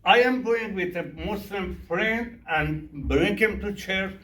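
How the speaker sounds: ordinary delivery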